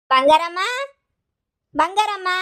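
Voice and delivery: loud call across the house, rising high. Acted. Mistress yelling for the missing maid, loud call. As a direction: shout her name to find the maid. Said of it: "ಯಾಕೆ ಹಿಂಗ್ ಕಿರ್ಚ್ಕೊತಾ ಇದೀಯಾ?".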